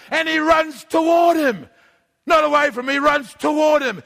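shouted preaching. Real, not acted. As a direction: shout the charge at the giant. Preacher building to climax.

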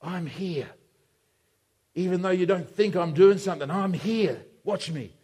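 low, soft, steady assurance; calm, understated comfort; quiet reassurance. Acted. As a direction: settle them with a hushed voice. Acts Jesus quietly reassuring disciples.

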